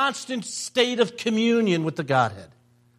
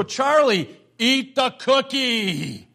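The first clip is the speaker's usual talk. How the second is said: slow, drawn-out urging; mock urging; 3 semitones higher, slower than usual. Grandfather wanting to tell his grandson to give in, mock urging. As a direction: stretch the words as if egging a little boy on. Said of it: "I just wanted to go".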